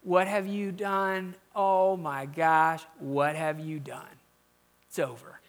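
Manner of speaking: slow, low, sinking condemnation; condemning. Acted. Preacher imagining God passing judgment, condemning. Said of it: "Or maybe you hear condemnation".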